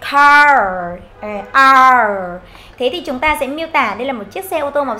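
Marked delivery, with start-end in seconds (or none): 0.1-2.4 loud, long "Car" ending in curled R; AR sound. Shown as a demonstration. Teacher drilling the AR sound, loud drawn-out "Car". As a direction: hold the vowel in "Car", then the R. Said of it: "Âm A R nhá".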